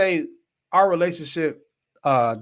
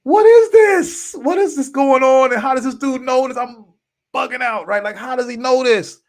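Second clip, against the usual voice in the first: very high, loud freaked-out wail; hysterical; 12 semitones higher, 8 dB louder than usual. Retold or imitated. Storyteller reliving the shock of being read correctly, hysterical. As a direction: act out bugging out, voice shooting way up. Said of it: "I'm hysterical".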